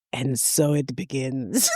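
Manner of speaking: low, slow, doom-laden murmur; deadpan low and slow foreboding; ominous assistant. Retold or imitated. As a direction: intone it like a prophecy of doom. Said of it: "She goes".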